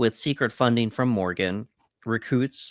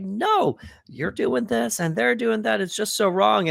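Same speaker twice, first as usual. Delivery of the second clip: high-pitched indignant mock rant; retold going off; 10 semitones higher than usual. Heroine going off on the men in the hallway, retold in high, indignant protest. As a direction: act out her going off on them, pitched way up. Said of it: "she's going off on these guys".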